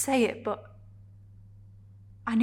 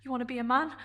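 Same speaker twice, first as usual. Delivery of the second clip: restrained challenge, rising at the end; challenging question; much faster than usual. Acted. Character listing Adrian's demands, challenging. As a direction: throw the question at them as a challenge.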